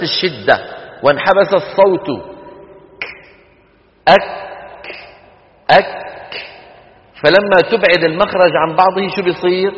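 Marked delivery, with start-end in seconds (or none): 4.1-6.1 أك closed hard, then a puff of breath; kaf stop then breath. Shown as a demonstration. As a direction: release أك with an audible breath. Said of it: "تقولها هكذا". (أك بشدة ثم همس)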